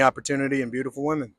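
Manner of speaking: ordinary delivery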